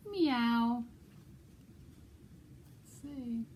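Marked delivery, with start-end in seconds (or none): 0.1-0.8 high, louder meow; playful kitty meow; cat meow. Pretend. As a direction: mew like a cat for the kids. Librarian imitates cat.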